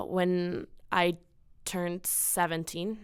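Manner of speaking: usual speaking voice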